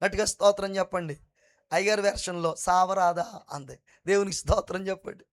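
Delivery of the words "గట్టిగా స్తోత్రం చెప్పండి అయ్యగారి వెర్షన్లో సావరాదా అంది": usual speaking voice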